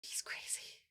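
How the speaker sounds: breathy whispered aside. Real, not acted. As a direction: whisper it like a secret.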